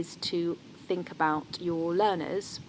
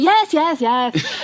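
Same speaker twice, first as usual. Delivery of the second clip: much higher, louder mock brush-off; hammed-up yes yes yes of a bored child; impatient kid; 10 semitones higher, 11 dB louder, much slower than usual. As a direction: imitate a child cutting a parent off.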